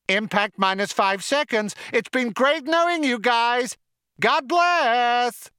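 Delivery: high, gushing computer farewell; chirpy computer. A narrator's reading. Computer saying goodbye before impact, high chirpy voice.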